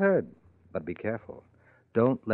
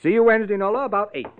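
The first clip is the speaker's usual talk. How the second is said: breezy insistence; 11 semitones higher, 5 dB louder, faster than usual. Acted. Paul brushing off refusal.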